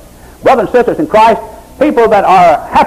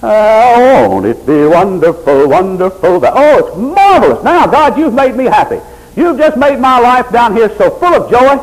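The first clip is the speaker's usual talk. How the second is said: gushing, high mock delight; a hint of a smug happy member; mock happy churchgoer; 7 semitones higher, 3 dB louder, slower than usual. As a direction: lightly ham up a self-pleased believer. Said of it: "You want God to".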